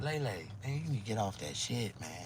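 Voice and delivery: soft, concerned plea; quiet worried advice. Retold or imitated. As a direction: gently urge her to quit drugs. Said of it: "And I was like".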